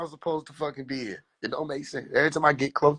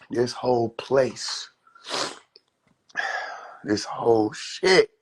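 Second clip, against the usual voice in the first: weary sigh; 6 semitones lower, much slower than usual. Retold or imitated. Weary, sighing disbelief.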